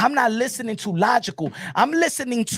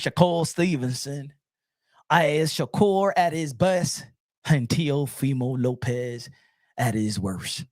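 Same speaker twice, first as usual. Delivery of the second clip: Joel Osteen; 2 semitones lower than usual. Acted. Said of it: "I'm gonna talk like him".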